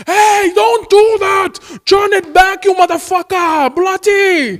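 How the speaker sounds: high, loud mock shout; hammed-up outraged yelling in Indian accent; angry Indian scammer. Scammer scammed back, furious mock Indian shouting. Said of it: "they get so angry".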